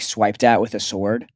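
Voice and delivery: ordinary talk, nothing marked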